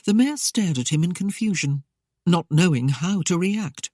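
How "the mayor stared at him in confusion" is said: ordinary talk, nothing marked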